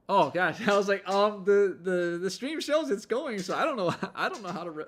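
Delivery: high, loud, laughing fluster. Real, not acted. Streamer telling viewers about the mic trouble, flustered and laughing.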